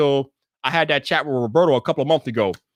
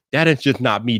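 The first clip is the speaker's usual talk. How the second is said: normal talking voice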